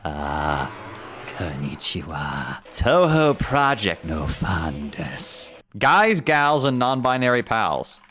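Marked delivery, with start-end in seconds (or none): 0.0-5.5 deep, slow put-on Japanese voice; low and drawn out, like a strange game character; Japanese Gaster voice. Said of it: "the Japanese Gaster voice".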